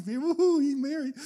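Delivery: high, delighted call of Mary; delighted Jesus. Retold or imitated. Jesus greeting Mary with delight, pitched high. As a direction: greet her with high joy. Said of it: "It's like".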